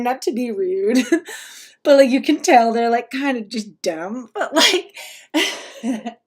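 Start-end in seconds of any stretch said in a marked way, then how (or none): none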